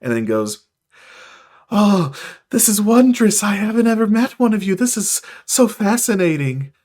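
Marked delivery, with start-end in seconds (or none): 1.7-6.7 high, gushing excitement; breathlessly eager, high-pitched marvelling; wonderstruck celestial. Acted. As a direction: gush in fascinated delight. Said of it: "his wings spread out".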